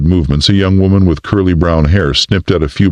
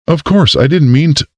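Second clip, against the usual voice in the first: flustered; 11 semitones higher than usual. A narrator's reading. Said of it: "Erica stuttered".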